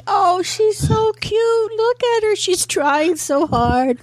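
high, slow, gushing pity; patronizing cooing. Acted. Casting people pitying the woman at the audition, high patronizing coo. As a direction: coo slowly and high as over someone pitiable. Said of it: "where they think".

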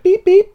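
high, loud mock electronic beeping; a beeping wand done by voice; imitated beeps. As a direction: squeal the beeps like a metal detector wand.

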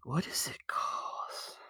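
soft, slow, hushed question; whisper. Acted. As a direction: whisper it like a secret. Mock-dramatic setup for the ad.

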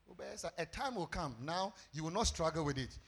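ordinary talk

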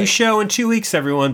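high, bright host announcement; excited announcement. Acted. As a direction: sell the news big. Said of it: "announcing to listeners".

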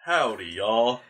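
slow, drawn-out joke Texan; playful parody of a Texas accent; mock Texas accent. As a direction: stretch it out in a hammed-up Texas drawl. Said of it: "like, Texas".

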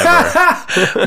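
high burst of laughter. Real, not acted. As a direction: crack up loudly. Laughing at Balut joke.

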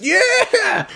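high, drawn-out cheer through laughter; mock cheering. Host imitating a crowd's raucous applause, mock cheering. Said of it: "Just raucous applause".

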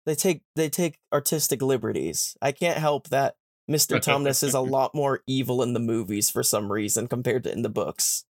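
ordinary delivery

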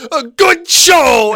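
high, loud, drawn-out imitation; mock theatrical cheer. Actor mimicking the scene's ending, mock cheer. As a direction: stretch it out, hammed-up.

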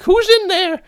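high, strained crackhead voice; twitchy slurred sniffing addict. Retold or imitated. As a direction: play a wired, jumpy junkie dad. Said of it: "crackhead voice".

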